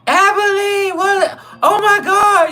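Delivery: high falsetto, flamboyant and delighted; flamboyant inmate voice. Retold or imitated. Flamboyant inmate at the fence greets narrator, high falsetto. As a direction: squeal his name like an excited old friend. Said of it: "eat 'em up, runs to the fence".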